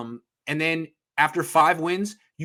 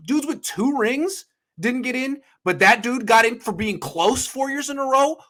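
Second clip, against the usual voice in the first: higher, slow and heated on the first words; getting worked up; 8 semitones higher than usual. Real, not acted. Host fuming about Hall of Fame picks, worked up. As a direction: climb into indignation. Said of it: "I'm getting excited".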